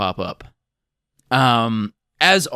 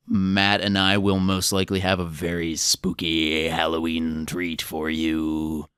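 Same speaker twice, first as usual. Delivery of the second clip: ordinary talk, nothing marked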